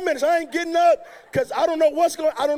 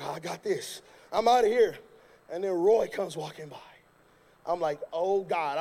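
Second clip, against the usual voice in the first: hushed, frightened aside; scared inner voice; 8 semitones lower than usual. Retold or imitated. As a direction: mumble it to yourself in dread. Retold fearful inner thought.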